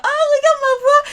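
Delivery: high-pitched, showing off a prized voice; hammed-up girlish delight, very high; gushing little girls. Girls thrilled with their own husky voices, mock gushing. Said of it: "On se sentait plus, on était là".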